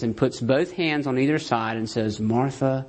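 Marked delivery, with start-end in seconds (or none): 2.2-2.8 Jesus speaking gently to an anxious Martha; tender rebuke. Retold or imitated. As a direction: hold her face and calm her gently. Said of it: "takes her face in his hands".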